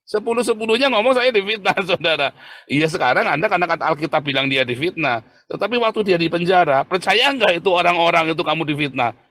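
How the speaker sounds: loud, high-pitched sermon outburst; impassioned preaching. Real, not acted. Berkhotbah berapi-api. (khotbah berapi-api dengan suara tinggi dan keras)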